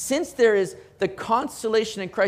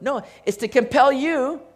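usual speaking voice

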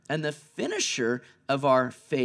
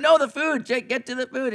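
exasperated pointing owner; 7 semitones higher than usual. Retold or imitated. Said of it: "You're like".

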